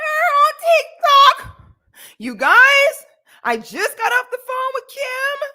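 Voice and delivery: high, loud, slow panicky mock voice; shrill mock panic of a frantic dad. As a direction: ham up a man freaking out on the phone. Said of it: "how his tone in this is, like, he is genuinely freaking out".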